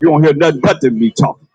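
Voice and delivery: ordinary talk, nothing marked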